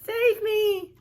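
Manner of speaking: high, loud call for rescue; mock cry for help. Prince calling from the window to be saved, loud. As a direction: cry out from the tower.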